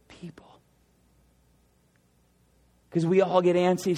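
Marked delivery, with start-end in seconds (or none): 0.1-0.6 breathy whispered ending word. Real, not acted. Preacher telling the church they belong to God, whispered. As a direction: let the last word fall to a whisper.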